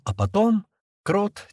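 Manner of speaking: ordinary delivery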